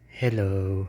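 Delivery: close-up hello; close to the phone. Shown as a demonstration. Testing the phone up close, close-miked hello. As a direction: lean into the phone. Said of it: "Tapos lalapit ko".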